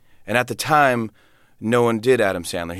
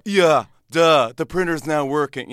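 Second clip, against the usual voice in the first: annoying IT guy; 5 semitones higher, slower than usual. Acted. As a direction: rub it in like a smug office techie. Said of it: "I T guy at the office who's annoying".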